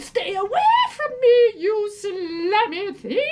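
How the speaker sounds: high, loud recoiling cry; girl shrieking at a slug; disgusted. A narrator's reading. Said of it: "Recoiling in disgust".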